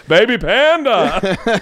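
high, drawn-out goofy comic voice; Brian Regan. Acted. Fans quote the comic's bit at him, slow exaggerated impression. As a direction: stretch it out in a hammed-up impression. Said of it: "Do Brian Regan to me".

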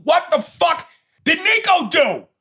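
high, drawn-out incredulous wail; exasperated disbelief. Retold or imitated. Viewer left hanging by the cliffhanger, exasperated disbelief. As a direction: wail it slowly, dying to know.

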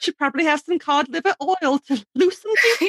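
high-pitched mock British advice; fake British accent. Co-host prescribing cod liver oil, fake British accent. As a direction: play along in a silly British voice. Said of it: "like, a fake British accent on the podcast".

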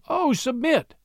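put-on high, delighted child's call; joyful call. A narrator's reading. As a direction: call out happily to a friend. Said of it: "Sarah called out joyfully".

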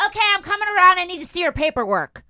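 high, loud mocking clerk voice; rude clerk. Mocking the clerk demanding dog paperwork, high and loud. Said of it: "She's like".

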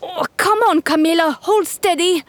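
high, impatient camel voice. Acted. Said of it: "another camel said to her friend".

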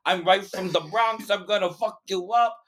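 high, hammed-up Bronx tough talk; broad New York accent. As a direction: imitate a brash Bronx New Yorker. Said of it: "one of two accents for America".

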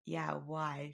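low, very soft question; wary reply. Retold or imitated. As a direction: answer quietly, unsure what's coming. Her wary answer to friend.

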